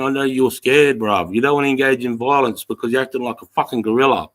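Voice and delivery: normal talking voice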